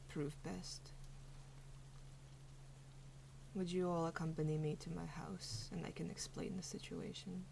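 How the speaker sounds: very soft, low invitation; sigh, then gently offer to explain; hushed, measured furbolg voice; soft-spoken furbolg host. Acted.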